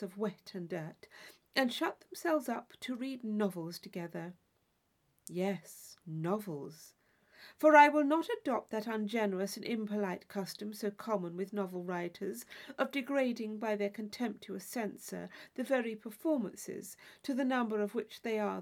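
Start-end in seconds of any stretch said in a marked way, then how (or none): none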